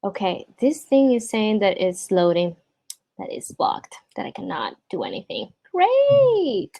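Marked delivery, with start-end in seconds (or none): 5.7-6.7 high, drawn-out great that falls away; exasperated singsong great; mock-delighted groan. Real, not acted. Presenter reacting to frozen software, mock delight. Sarcastic reaction to PowerPoint blocked.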